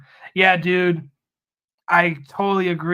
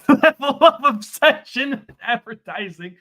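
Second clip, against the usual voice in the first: higher, louder, cracking up while talking; real laughing; 8 semitones higher, 8 dB louder than usual. Real, not acted. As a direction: laugh your way through the words. Cracking up at own joke.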